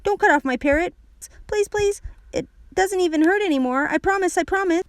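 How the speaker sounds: put-on high, urgent child's begging; high-pitched insistent promises; frantic pleading. A narrator's reading. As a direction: beg like a desperate little kid. Child begs mother not to cut.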